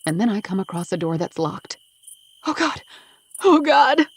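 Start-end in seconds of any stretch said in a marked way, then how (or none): 2.5-4.1 high, panicked cry, building louder; rising desperate exclamation; anguished cry. A narrator's reading. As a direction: cry out in dread, louder the second time. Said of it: "I cry".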